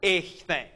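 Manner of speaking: echthen, said clearly as a model word; Greek word said aloud. Shown as a demonstration. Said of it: "Second one".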